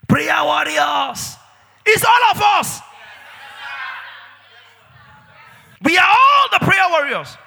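rally the church at full volume; rising to a fiery shout; preaching shout. Acted. Preacher building to a climax.

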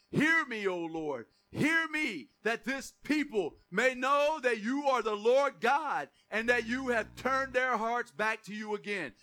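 loud, slow pleading prayer, building to the top; fervent cry to God. Acted. Preacher voicing Elijah, crying out to God for fire. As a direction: cry out to the Lord with everything.

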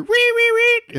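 very high squeaky bat noises; bat squeak. Retold or imitated. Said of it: "that was my bat impression".

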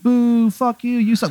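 high-pitched jeering heckler; heckling boo. Acted. Woman heckles the villain wrestler, jeering high. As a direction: boo him from the crowd. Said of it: "she's, like, really into it".

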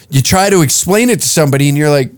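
normal talking voice

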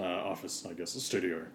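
ordinary delivery

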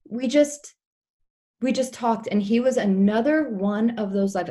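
ordinary talk, nothing marked

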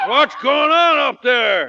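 loud, slow shout upward. Acted. Father shouting up at the noise upstairs, loud. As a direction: demand it up the stairs.

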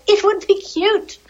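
imagine something adorable; cutesy wish. Retold or imitated. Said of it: "I really was just like".

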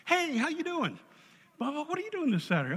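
high-pitched, delighted hail; excited greeting. Retold or imitated. Speaker hails a guy from many years ago, excited high greeting. Acts greeting an old acquaintance.

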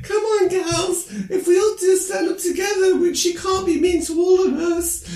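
very high, loud hammed-up rallying call; shrill rallying cry. Chris rallying the girls against the coach, shrill. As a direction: rouse the girls in a squeaky cry. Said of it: "and is like".

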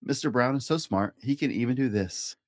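ordinary delivery

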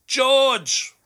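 high-pitched call-out of a name; yelled name. Retold or imitated. Domino's worker alerts staff to a waiting customer, yelling out. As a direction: yell it out as a long falling call. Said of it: "somebody will literally yell out".

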